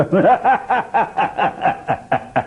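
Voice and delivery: high mocking stage laugh; long pulsed ha-ha-ha, starting high; S V Ranga Rao. Actor as Chanakya deriding his rival, theatrical laugh. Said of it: "Rangarao గారు చాణక్యుడు వేస్తే".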